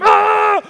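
high, loud cry of fright; scared scream. Acted. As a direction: shriek like a terrified disciple. Acts out the disciples' terror.

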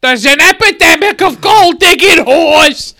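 high, loud shock-jock rant; radio host rant. Pretend. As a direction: yell it like talk radio.

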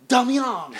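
high, loud shout of the name "Damian"; "Damian" yelled high with a falling end; calling out a name. Retold or imitated. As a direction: belt out "Damian" across the room. Interpreter calls the name out.

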